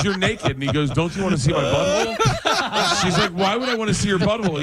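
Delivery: high, laugh-filled squeal; laughing re-enactment. Acted. Comic retelling the awkward exam, laughing through it. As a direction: act out both sides while cracking up.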